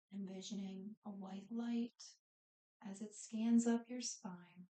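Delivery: unhurried breathing cues, phrases spaced far apart, softening as it goes; guided meditation. Real, not acted. Yoga instructor settles the class into breathing and body scan, guided meditation.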